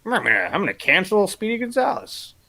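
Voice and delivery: put-on high voice of a self-important crusader; mocked article writer. Host mocking a cartoon article's writer, put-on crusader voice. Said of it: "what you decided to write".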